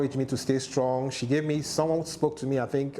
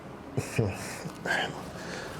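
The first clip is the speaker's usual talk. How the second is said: heavy sigh; 3 semitones lower, 6 dB quieter than usual. Real, not acted. Emotional pause after "brain dead.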